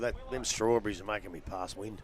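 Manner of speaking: ordinary talk, nothing marked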